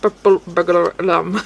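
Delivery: mock Scottish, syllables run together; bad Scottish accent. Streamer repeating favourite video's line, badly faked Scottish accent. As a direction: try a clumsy Scottish tongue-twister. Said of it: "I can't do the Scottish accent very well".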